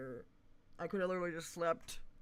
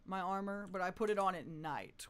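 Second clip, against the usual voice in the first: ordinary talk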